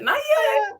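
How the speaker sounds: a silly high-pitched echo of yourself; squeaky high repeat. Shown as a demonstration. To a friend about the show, in a silly squeak.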